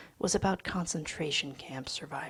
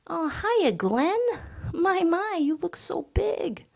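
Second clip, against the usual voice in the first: much higher, gushing greeting; effusive high voice; 12 semitones higher, 7 dB louder than usual. Acted. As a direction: gush over old friends. Louis coos at the baby.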